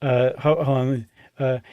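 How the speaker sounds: ordinary talk, nothing marked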